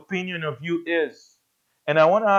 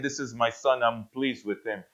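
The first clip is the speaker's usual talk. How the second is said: hushed voice from heaven; 6 semitones lower, 9 dB quieter than usual. Retold or imitated. Quoting voice from heaven.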